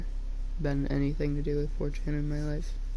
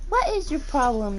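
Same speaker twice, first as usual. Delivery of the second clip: higher, louder indignant demand; indignant scold; 7 semitones higher, 3 dB louder than usual. Real, not acted. Owner indignantly scolding her cat Nix. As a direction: demand an answer from the pet.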